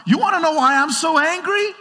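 high, challenging, rising question; mock indignant. Angry person answering the questioner, high and indignant. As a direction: dare them to ask. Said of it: "people are like".

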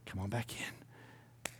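slow, soft whispered invitation; tender whispered welcome. Retold or imitated. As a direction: breathe it like a gentle welcome home. God welcoming sinner back.

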